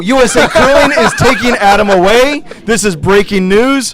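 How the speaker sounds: loud, high, hyped commentator shout; breathless-with-drama sportscast; breaking-news frenzy. Real, not acted. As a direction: announce the scandal at full excitement. Announces dramatic removal as breaking news.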